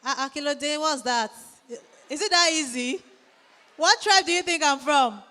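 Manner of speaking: usual speaking voice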